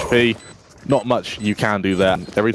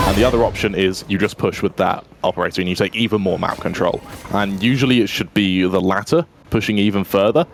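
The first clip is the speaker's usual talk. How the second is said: usual speaking voice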